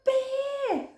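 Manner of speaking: high, loud thrilled; excited. Acted. Hare spotting the bear, high thrilled voice. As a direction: cheer the sighting.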